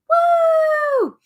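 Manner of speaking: high, loud cheer; excited whoop. Real, not acted. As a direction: shout for joy at the win. Cheers Derek's veto win.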